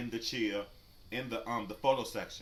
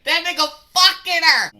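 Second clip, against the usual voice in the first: much higher, much louder slow shout; mock gossip yell; 19 dB louder than usual. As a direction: holler like nosy bystanders. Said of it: "people are".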